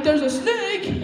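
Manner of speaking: high, slow sing-song warning; drawn-out singing alarm; mock panic. Telling his buddy about the snake, high and sung. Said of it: "And I'm like".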